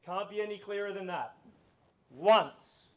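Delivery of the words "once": auction call. Real, not acted.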